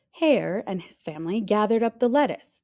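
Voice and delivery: usual speaking voice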